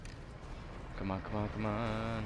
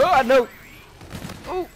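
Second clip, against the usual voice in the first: startled shout; 14 semitones higher, 6 dB louder than usual. Real, not acted. Sudden gunfire nearly hits him.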